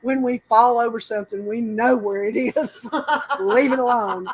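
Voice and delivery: mock telling-off with a laugh breaking in; retold exasperation. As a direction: imitate a fed-up friend, laughing. Retold scolding, told as funny story.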